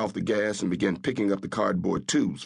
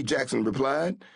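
ordinary delivery

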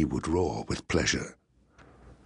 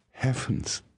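higher-pitched, understated gasp; a touch of startled relief; 7 semitones higher than usual. A narrator's reading. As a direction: gasp it lightly in surprise. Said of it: "she gasped".

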